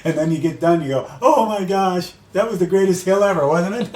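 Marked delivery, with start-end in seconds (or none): 1.2-4.0 high-pitched, giddy retold delight; elated exclamation. Finisher looking back on the hill, mock elation. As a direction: gush with relief, breaking into a laugh.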